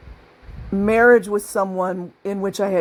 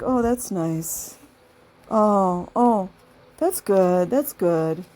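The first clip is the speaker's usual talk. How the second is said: unimpressed reply; much slower than usual. Retold or imitated. Jealous narcissist brushing off your good news, slow and unimpressed. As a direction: drag it out like you couldn't care less. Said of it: "They're just gonna be like".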